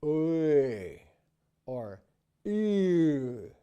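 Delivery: loud demo of wrong diphthongs. Shown as a demonstration. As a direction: show the wrong sliding vowels. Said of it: "this sound is not a diphthong".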